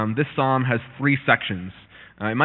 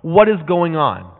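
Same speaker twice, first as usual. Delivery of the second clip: loud, high accusation at God; angry; 5 semitones higher, 11 dB louder than usual. Acted. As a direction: confront God, outraged and shouting. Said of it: "He's angry".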